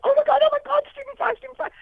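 very high starstruck squeal; squealing excitement. Retold or imitated. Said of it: "the excitement, like".

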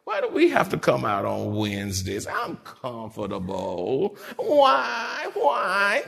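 mock whining. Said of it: "They whine".

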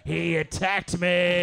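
slow, drawn-out cry of accusation; hammed-up victim appealing to onlookers; mock accusing wail. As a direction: stretch it out like a wronged man. Fallen man accusing him before bystanders.

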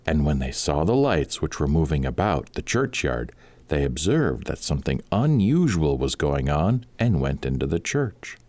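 plain reading voice

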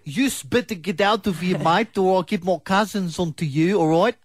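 put-on Greek accent. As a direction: threaten in a hammy Greek accent. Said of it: "That was not a Greek accent".

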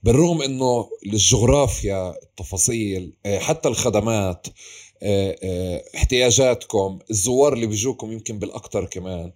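normal talking voice

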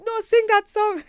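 high, loud pleading voice of eager relatives; family begging. Retold or imitated. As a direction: imitate the family demanding a song. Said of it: "They're like".

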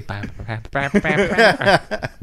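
slow bam bam gunshot imitation; gunshot sounds. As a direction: mimic the shots one by one. Imitating Trinity shooting.

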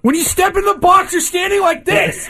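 high, shouting coach parody; mock manager pep talk. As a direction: yell instructions like a fired-up manager. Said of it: "Bob Melvin giving the speech".

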